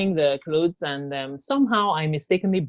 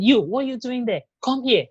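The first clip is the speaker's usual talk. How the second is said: calling out; 7 semitones higher than usual. Retold or imitated. Abuser calls out to brother.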